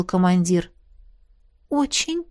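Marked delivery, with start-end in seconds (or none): 1.7-2.2 high, hoarse little answer; hoarse squeak. A narrator's reading. Heroine answers whether she wants to live, hoarse squeak. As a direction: wheeze it out, pitch raised. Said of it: "просипела я".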